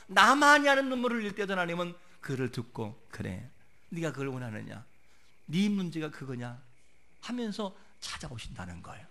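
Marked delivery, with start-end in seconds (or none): 3.1-6.6 soft, low, tender question; gentle divine reply. Retold or imitated. God answering a tearful believer, gently asking. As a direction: answer softly, low and reassuring.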